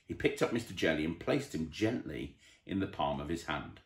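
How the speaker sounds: ordinary delivery, nothing marked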